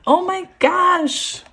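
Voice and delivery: real wonder stretched out slowly; amazed. Real, not acted. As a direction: savour the gasp of delight. Amazed by the cards.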